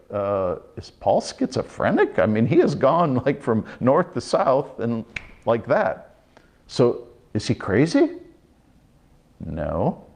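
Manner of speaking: normal talking voice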